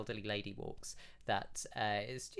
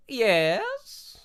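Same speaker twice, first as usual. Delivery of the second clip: priestess voice; 11 semitones higher, 11 dB louder than usual. Acted.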